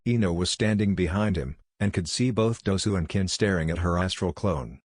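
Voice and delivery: usual speaking voice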